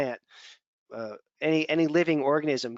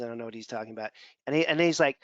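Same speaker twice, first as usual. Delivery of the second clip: ordinary talk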